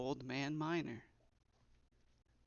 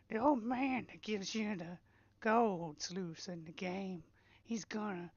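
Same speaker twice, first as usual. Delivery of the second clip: higher, slow put-on old man voice; old man voice try; 6 semitones higher than usual. Shown as a demonstration. Streamer tests a voice for his game's old man, high and slow. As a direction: draw it out like a wobbly old man. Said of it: "that sounds too much like Consuelo from Family Guy".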